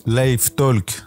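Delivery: a clumsy try at an English accent on "Live Talk"; English accent attempt. Shown as a demonstration. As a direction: say "Live Talk" the English way, not quite pulling it off. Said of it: "Mon accent anglais, c'est pas trop ça". (essai d'accent anglais raté)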